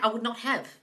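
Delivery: ordinary talk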